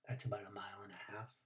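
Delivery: soft, fast low aside; quick aside. A narrator's reading. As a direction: toss it off under the breath.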